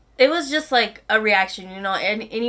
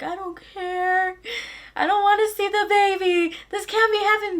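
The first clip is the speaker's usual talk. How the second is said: crying protest; 10 semitones higher than usual. Retold or imitated. Teen refusing to see the baby scan, tearful. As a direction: beg it not to be true through tears.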